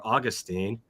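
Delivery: normal talking voice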